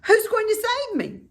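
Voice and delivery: high, loud, fast plea; eager desperate question. A narrator's reading. Wilbur desperately asks Charlotte, high and fast. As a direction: blurt it out hoping.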